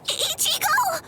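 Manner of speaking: gasp, then cry his name; high, tearful call to a hurt friend; alarmed cry. Acted. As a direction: cry out in distress.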